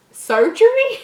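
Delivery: high, loud disbelieving question; incredulous surgery. Real, not acted. Reader stunned that it took many doctors. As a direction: throw it out in mock disbelief.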